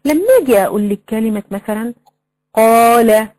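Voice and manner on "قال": قال with an open, sustained alif; madd with alif. Shown as a demonstration. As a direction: hold the long aa in قال. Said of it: "هذا مد بالألف".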